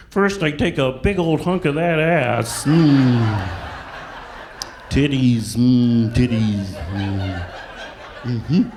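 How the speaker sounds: slow, loud hammed-up frog puppet; Kermit the Frog. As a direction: croon it like a lusty frog puppet. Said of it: "like Kermit the Frog".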